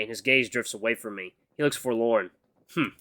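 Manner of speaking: plain reading voice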